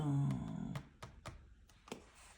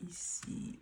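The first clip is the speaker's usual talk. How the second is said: whispering; 11 dB quieter, much slower than usual. Real, not acted. Softly opening a new item.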